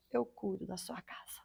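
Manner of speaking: hushed, breathy promise of God; soft whisper of God's reassurance; whispered divine promise. Retold or imitated. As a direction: breathe it like a secret from heaven. God's promise told in quiet awe.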